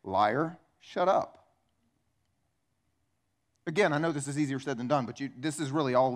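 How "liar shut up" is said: firm dismissal. Shown as a demonstration. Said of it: "look the person in the eyes and say".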